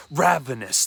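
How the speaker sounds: ordinary delivery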